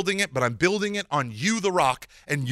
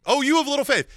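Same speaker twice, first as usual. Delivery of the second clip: higher, louder mock rebuke; 8 semitones higher, 4 dB louder, faster than usual. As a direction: scold Peter, raising the voice on "you". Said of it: "he rebuked him".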